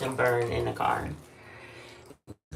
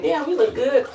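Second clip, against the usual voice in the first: impressed; 10 semitones higher, 5 dB louder than usual. Retold or imitated. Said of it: "I'm like".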